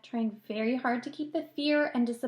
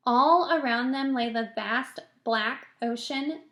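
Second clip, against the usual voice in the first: ordinary delivery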